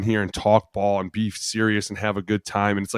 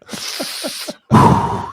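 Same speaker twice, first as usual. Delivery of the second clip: loud, forceful blown-out breath; imitated ammonia-jolt whoosh; sharp exhale; 4 dB louder than usual. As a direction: blast air out as if hit by smelling salts. Imitating player after sniffing ammonia.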